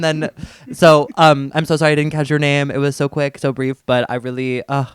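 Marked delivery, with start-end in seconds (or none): none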